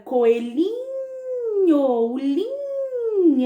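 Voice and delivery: lin held long in coelhinho; stressed syllable. Shown as a demonstration. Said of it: "mais forte de cada uma delas".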